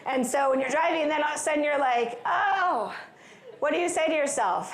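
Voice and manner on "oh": high, drawn-out "oh" of dismay; mock dismay, pitched up; dismayed realization. Driver spotting a missed exit, mock dismay.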